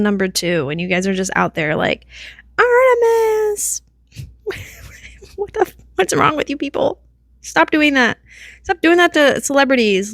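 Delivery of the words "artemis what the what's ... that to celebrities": high, hammed-up exasperated star shouting at fans; a star snapping at fans camped by the bus, high and shrill; mock celebrity yelling at fans. Star yelling at fans outside the bus, hammed-up high.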